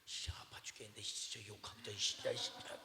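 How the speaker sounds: put-on hushed, sulky grumble of a hurt child; whiny hushed kid. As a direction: mutter it back like a sulking boy. Child whining back after falling. (넘어진 아이의 투덜거림)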